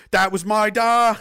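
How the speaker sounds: drawn-out, loud half-Irish Newfoundland; bad Newfoundland accent. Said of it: "Minus the bad half Irish Newfoundland accent".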